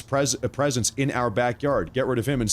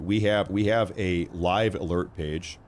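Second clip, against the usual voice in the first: usual speaking voice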